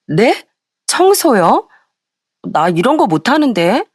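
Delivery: taken aback, pitch swooping up on the questions; grumbling protest. A narrator's reading. Sister-in-law told to clean up dog hair, grumbling in protest. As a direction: voice a reluctant sister-in-law balking at chores. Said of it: "신우들은 투덜대며 청소를".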